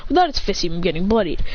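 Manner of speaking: plain reading voice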